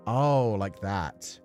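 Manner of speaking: ordinary delivery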